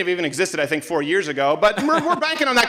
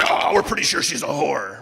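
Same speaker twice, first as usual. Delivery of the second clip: lower, dismissive mock voice; mock critics; 8 semitones lower than usual. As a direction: play the smug outsiders. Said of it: "they're like".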